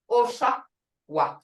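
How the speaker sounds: Osawa said slowly, with the sound felt at the base of the throat; slow Creek word demo. Shown as a demonstration. Teacher models Osawa slowly for students to repeat. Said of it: "I want y'all to say it".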